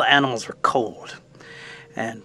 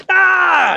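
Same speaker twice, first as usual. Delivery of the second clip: short, loud, high-pitched acted scream; high startled scream; 7 dB louder than usual. Raccoon hit by a popping spark, screaming. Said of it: "he screams".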